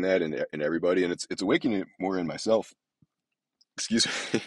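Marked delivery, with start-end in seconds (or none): 3.8-4.4 mid-sip, a little off; while sipping a drink. Real, not acted. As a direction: say it right after a sip. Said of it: "I am drinking a chai tea latte right now".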